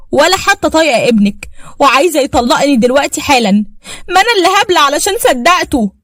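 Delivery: the wife raging to her mother-in-law, furious. Acted. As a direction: spit out the hurt at the mother-in-law. Furious wife demands divorce.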